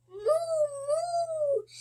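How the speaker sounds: very high playful moo; the cow calling out, high sing-song moo. A narrator's reading. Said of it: "says the cow".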